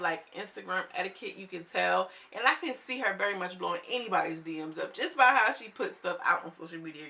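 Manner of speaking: usual speaking voice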